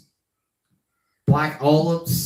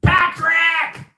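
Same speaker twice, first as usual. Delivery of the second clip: Squidward impression; 13 semitones higher than usual. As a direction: yell it out like an annoyed cartoon neighbor. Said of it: "Oh, in this one".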